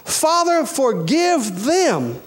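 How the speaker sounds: ordinary talk, nothing marked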